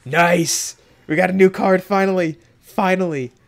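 loud, high-pitched celebration; excited cheer. Real, not acted. Player finally pulling a new card after waiting so long, excited cheer. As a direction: exclaim it loudly with real excitement.

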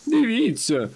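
high-pitched alerting cry; exclaiming. A narrator's reading. Dumdidum alerting friends to what is ahead, exclaiming. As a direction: call out high, falling at the end. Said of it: "вигукнув Думдідум".

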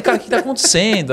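retold disbelief, high and loud; high-pitched, loud bafflement; bewildered exclamation. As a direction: ask it like things are getting out of hand. Bewildered at the shouting crowd.

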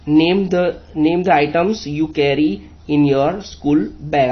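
very slow, every word separate; slow instruction reading. Shown as a demonstration. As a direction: read the task slowly to pupils. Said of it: "आपको क्या करना है". (धीरे-धीरे निर्देश पढ़ना)

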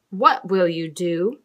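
usual speaking voice

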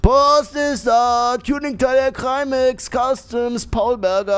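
high, slow, loud announcing; delivery man call. Pretend.

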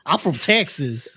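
slow, badly faked Texan accent; hammed-up mock Texan accent. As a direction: claim Texas in a dubious drawl. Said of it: "that ain't a Texan accent".

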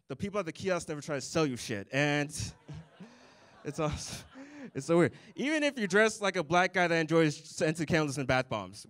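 ordinary talk, nothing marked

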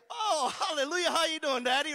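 loud, high, laughing hallelujah; joyful greeting. Pretend. Pastor acts greeting God in heaven, loud and laughing. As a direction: burst out laughing with joy at heaven's door. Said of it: "My very next breath, I'd be".

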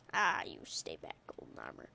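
whispered warning, no voice at all. Acted. Gamer whispering a warning at the Golden Armor enemy. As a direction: breathe it like a secret threat.

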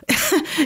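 a forced laugh with nothing behind it; hollow, joyless laugh. A narrator's reading. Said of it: "Draco lachte, obwohl der Klang hohl war".

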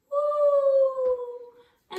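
very high flying whoop; high excited woo. Acted. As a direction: soar up with the owl. Owl flies into forest.

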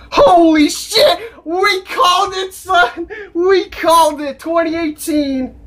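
high, drawn-out triumphant cries; jubilant shouting. Real, not acted. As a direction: celebrate being right at full pitch. Celebrating a correct release guess.

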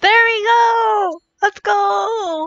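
high, loud victory yell; triumphant cheer. Real, not acted. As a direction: cheer the win at full voice. Finally gets the pull.

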